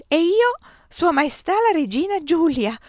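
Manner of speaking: very high, loud exclamation; overwrought proclamation. A narrator's reading. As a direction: announce the title in agitation. Sister stunned at becoming queen.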